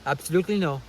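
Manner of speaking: rough imitation of an Indian guru accent; Sadhguru accent. Vlogger retrying the guru's refusal, imperfect Sadhguru accent. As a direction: have another try at the guru's Indian accent. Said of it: "I can't do a good Sadhguru accent".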